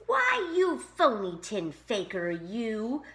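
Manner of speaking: gruff angry growl. A narrator's reading. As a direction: growl it like an old grump. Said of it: "growled the old grump".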